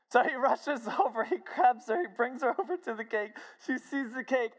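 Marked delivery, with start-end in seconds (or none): none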